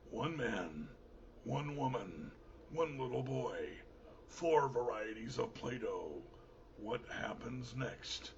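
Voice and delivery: low, hushed trailer announcer; mock-epic low voice-over; movie trailer voice. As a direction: play it like an action-film promo, soft and deep. Performs a trailer for his show.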